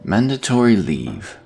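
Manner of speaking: ordinary delivery, nothing marked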